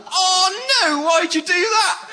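mock outrage, pitched high; livid husband. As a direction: wail it in indignant disbelief. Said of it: "he's livid".

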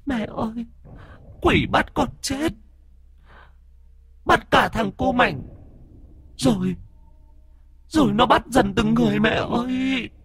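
put-on a scared dead girl warning of the demon; ghost girl's voice. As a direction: beg the master in a thin high child's voice. Ghost girl speaks to mother.

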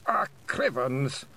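tired, muffled little groan; slow, weary and muffled. Acted. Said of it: "weary and rather muffled voice".

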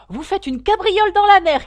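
high, very loud cry; wild shout. A narrator's reading. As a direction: shout like someone capering madly. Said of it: "cria la fausse tortue". (crier comme une folle)

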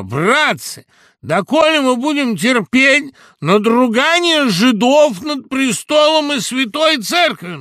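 громкий высокий надрывный голос; лёгкий намёк на слезливый надрыв; дрожащим плачущим голосом. Acted. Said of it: "говорил он дрожащим, плачущим голосом". (надрывно-плаксивая речь)

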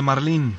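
"marlín" with the stress on LÍN; stress on last syllable. Shown as a demonstration. Host trying it as a restaurant order, end-stressed "marlín". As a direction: land on the second syllable of "marlín". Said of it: "la pronunciación correcta es marlín".

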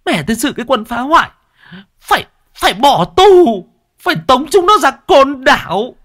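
very high, shrill-pitched outraged woman; furious wife. Acted. Bald man's wife rants to Tẩy, very high furious voice. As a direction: shriek your outrage at the wreckers.

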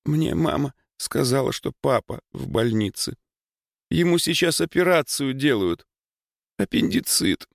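high, sobbing girl's voice, lightly acted; tearful. A narrator's reading. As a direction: tell bad news through mild sobs. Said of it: "Она говорила и всхлипывала". (всхлипывая)

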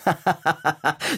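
high, staccato villain cackle; big acted ha-ha-ha; robber's mocking laugh. A narrator's reading. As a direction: laugh in hammed-up bursts like a storybook robber. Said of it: "Da lachte der fremde Mann".

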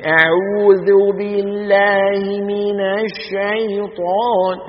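slurred "أعوذ بالله من الشيطان" with slack jaw, very slow; drunk slurred mock. Shown as a demonstration. Teacher mimics a drunk reciter: slurred drawl. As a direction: chew the letters like a drunk man, dragging. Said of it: "السكران يعني بصير عنده ارتخاء في عضلات الفم".

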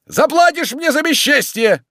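very high, loud parting scream; yelled threat. Acted. Tarantyev leaving across the yard, yelled threat. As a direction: yell it back at the house. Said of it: "кричал он, идучи по двору".

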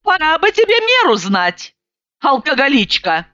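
high-pitched, indignant scolding; exasperated yelling. A narrator's reading. Sober woman scolds her drinking friend, yelling indignantly. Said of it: "завопила я".

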